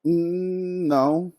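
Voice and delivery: drawn-out, reluctant no; hesitant no. Real, not acted. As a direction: hum over it, then quietly say no. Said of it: "Why you say it like that?".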